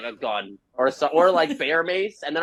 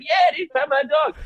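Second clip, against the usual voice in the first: much higher, louder excited dog owner; dude with dog; 14 semitones higher, 3 dB louder than usual. Retold or imitated. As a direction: squeak it out like an eager hiker. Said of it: "He's like".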